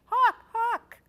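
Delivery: very high, very loud cries; crying out. A narrator's reading. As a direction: scream the warning to everyone. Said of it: "he cried".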